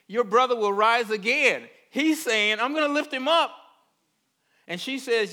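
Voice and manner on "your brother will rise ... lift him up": loud, high, insistent declaration; emphatic promise. Acted. Preacher voicing Jesus promising to raise her brother, loud and emphatic. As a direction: declare it forcefully, pitched up.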